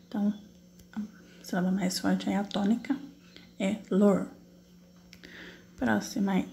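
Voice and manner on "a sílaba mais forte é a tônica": normal talking voice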